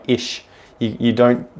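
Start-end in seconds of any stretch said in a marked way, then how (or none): 0.1-0.4 "ish" with a plain English sh; "ish" with sh instead of German ch; English sh ending. Shown as a demonstration. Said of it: "You don't do it like".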